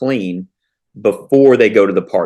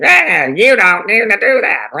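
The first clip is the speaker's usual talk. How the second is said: high, put-on scolding voice; mock nagging parent; 11 semitones higher, 4 dB louder than usual. Parent mocking how they sound scolding their kids, high scolding voice. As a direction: lampoon yourself nagging a kid. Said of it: "I was like".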